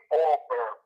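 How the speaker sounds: normal talking voice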